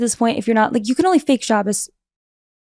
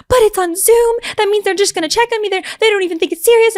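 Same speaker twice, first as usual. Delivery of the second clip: higher mock panic over Zoom; panicked whine; 10 semitones higher than usual. Fretting to herself about a Zoom meeting, higher panic. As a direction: whine it like the sky is falling.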